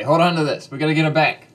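loud urgent call, pitched a bit up. Acted. An adventurer tells Drift to grab the rope, loudly urgent. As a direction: call it out over the noise.

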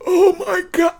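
high mock-mortified exclamation; hammed-up secondhand embarrassment; retold horrified shock. Reacting to embarrassing mom scene.

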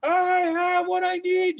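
high, drawn-out heroic battle cry; He-Man. Retold or imitated. Fan holding guitar aloft, heroic proclamation. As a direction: proclaim it slowly, holding up your trophy. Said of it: "you know, like He-Man style".

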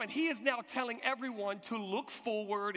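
normal talking voice

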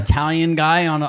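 ordinary talk

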